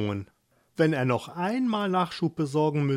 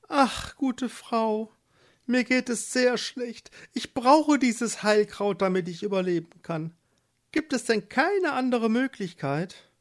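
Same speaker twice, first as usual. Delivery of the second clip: pleading wife; 8 semitones higher than usual. Acted. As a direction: beg the witch for mercy.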